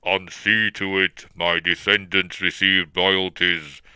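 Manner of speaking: slow, measured villain voice; drawn-out, imposing villain delivery; Darth Vader. Acted. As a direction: intone it heavily, word by word. Quote redone in Vader's voice.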